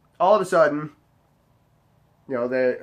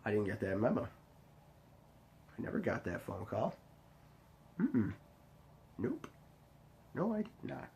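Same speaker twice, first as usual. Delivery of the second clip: much softer, lower flat denial; muttered denial; 5 semitones lower, 18 dB quieter than usual. Retold or imitated. Retelling to friends how he quietly denied it. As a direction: mutter it under your breath, sulking. His own sulky retold reply.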